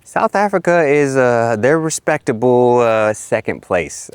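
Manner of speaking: high, slow wry emphasis; drawn out playful ranking; teasing. Acted. Joking ranking.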